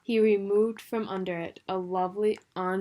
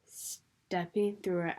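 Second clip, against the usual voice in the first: ordinary delivery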